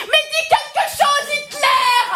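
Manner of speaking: cri exaspéré, très aigu; exaspérée. Acted. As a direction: exploser à bout de patience, voix perchée. Explose pendant le jeu. (exaspérée, cri aigu)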